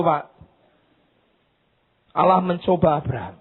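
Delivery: ordinary talk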